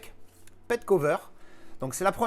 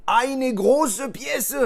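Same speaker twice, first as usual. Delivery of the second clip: excited announcement; 8 semitones higher, 6 dB louder, much slower than usual. Pretend. Announcing the unboxed item.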